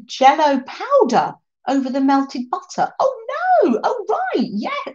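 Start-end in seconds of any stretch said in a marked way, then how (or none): 3.0-4.9 high, surprised realization. Real, not acted. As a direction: react with sudden amazement. Realizing what Jell-O toast is.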